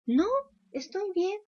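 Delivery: muy agudo, vocecita infantil; voz de niño. Retold or imitated. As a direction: hazte el niño que dice que está bien. Niño aburrido responde. (vocecita aguda de niño)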